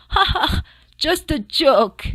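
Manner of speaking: loud, high chortle; chortling fox. Acted. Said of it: "he chortled".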